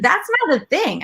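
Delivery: high, incredulous parent; retold exasperated correction; dismissive scolding.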